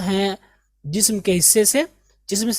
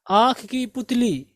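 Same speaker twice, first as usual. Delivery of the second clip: usual speaking voice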